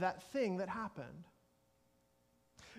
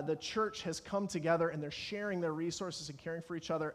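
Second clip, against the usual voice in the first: ordinary talk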